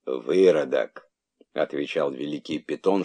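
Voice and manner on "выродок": презрительно. A narrator's reading. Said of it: "чтобы не опускаться до спора".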